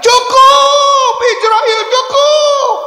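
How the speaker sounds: high, loud crying scream; anguished cry. Acted. The Prophet in pain cries out, high anguished scream. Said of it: "Rasulullah teriak".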